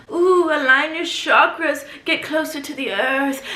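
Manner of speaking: airy, slow hippie voice; mock hippie. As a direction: drift dreamily like a spiritual guru. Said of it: "she's like".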